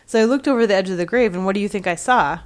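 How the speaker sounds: fast and loud, rushing the story; tumbling out an eager tale; a sexton breathlessly telling his wife; excited. A narrator's reading.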